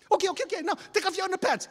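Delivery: high, loud hysterical rush; acted hysterical kid; frantic. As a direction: blurt it out in a panicked squeal. Crying Keoki insists frantically.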